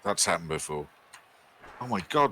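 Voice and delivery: ordinary talk, nothing marked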